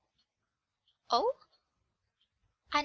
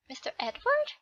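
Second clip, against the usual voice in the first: higher, curious "Mister Edward?"; puzzled echo; 8 semitones higher than usual. Acted. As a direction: try the strange name out in wonder. Girl echoes unfamiliar name.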